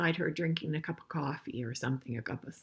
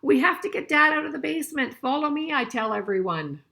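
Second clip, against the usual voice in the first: put-on reading a picture book aloud to kids, a bit louder; storybook reading voice; story reading; 6 semitones higher, 8 dB louder than usual. A narrator's reading. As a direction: read it out clearly for the class.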